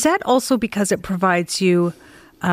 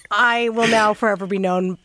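usual speaking voice

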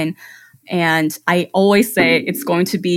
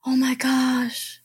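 breathless whispered awe; awed whisper; 5 semitones higher, 7 dB quieter, much slower than usual. Retold or imitated. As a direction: whisper it, stunned. Clients gasp at prints.

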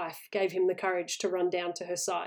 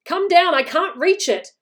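high, loud call for help; desperate; 10 semitones higher, 10 dB louder than usual. A narrator's reading. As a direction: shout urgently down the house. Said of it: "desperate and breathing heavily".

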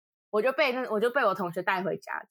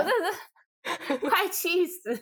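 exasperated; 9 semitones higher, much slower than usual. Real, not acted.

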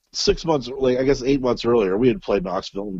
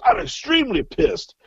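mock fury. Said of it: "He goes".

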